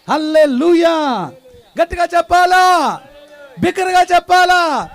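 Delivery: loud, rousing sermon climax; preaching at full force. Acted. Preacher rousing the congregation to shout amen, loud. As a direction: lift the crowd with a booming call.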